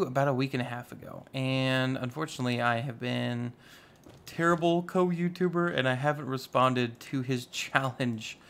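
ordinary delivery

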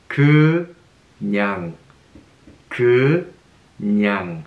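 "그냥" drawn out slowly for learners; slow drill. Shown as a demonstration. Teacher modelling the bonus word, slow and clear. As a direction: stretch "그냥" so every sound is heard.

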